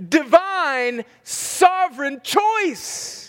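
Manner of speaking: preacher building to a climax; preaching at full force. Acted. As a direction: build to the sermon's peak, hit pure and sovereign. Sermon climax.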